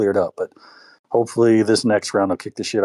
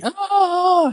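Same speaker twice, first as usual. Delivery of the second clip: a sudden shrill scream of pain, much higher; high yelp. Retold or imitated. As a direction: yelp as if bitten. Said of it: "He's like".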